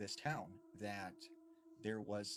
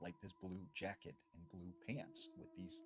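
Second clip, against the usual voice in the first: ordinary delivery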